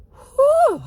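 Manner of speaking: long high-pitched exhale whoop; loud high whew. Real, not acted. Driver reacting to a passenger turning aggressive, loud whew. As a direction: let out a big "whew".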